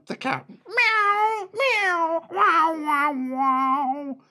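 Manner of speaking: squeaky high meows and woofs; animal music. Acted. The animals mix their music, high meowing and barking. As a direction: sing out the animal noises.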